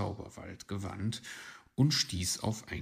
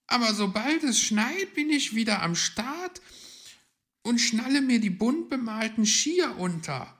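high, loud, keen voice; loud, high character voice; eager young character; 8 dB louder than usual. Acted. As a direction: declare it excitedly, pitched right up.

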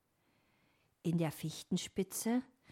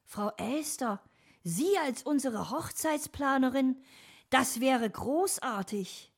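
higher, louder delight; enthusiastic; 7 semitones higher, 5 dB louder than usual. A narrator's reading. Dominik wants magpie as wedding planner, raised enthusiastic voice. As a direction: welcome the idea with eager approval. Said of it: "war Dominik Feuer und Flamme".

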